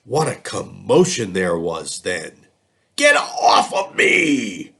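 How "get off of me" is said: high, loud, slow roar; the lion bellows to be let go. A narrator's reading. Lion squashed under the elephant, loud high roar. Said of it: "roared the lion".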